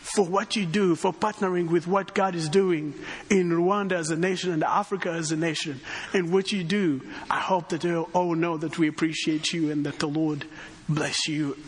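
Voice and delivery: ordinary talk, nothing marked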